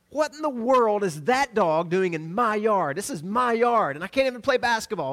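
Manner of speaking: retold childish indignation; mock indignant kid. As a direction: act out a kid's outrage, half joking. Kids indignant at dog in yard.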